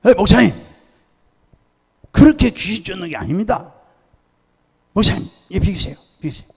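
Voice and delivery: loud, high-pitched objection; brusque interruption. Retold or imitated. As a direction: cut in forcefully and take over. Pastor interrupts the failed exorcism.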